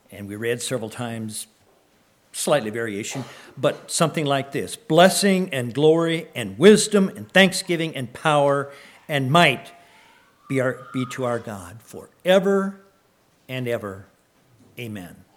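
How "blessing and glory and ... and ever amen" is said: a lightly raised reading voice, fading at the end; quoted doxology. Acted. Pastor recalling the heavenly praise for the church, lightly proclaimed. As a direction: recite the praise, then settle down.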